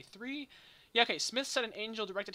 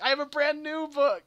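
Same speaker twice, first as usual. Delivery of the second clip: laughing mock excitement; 9 semitones higher, 13 dB louder than usual. Story quote climax, laughing.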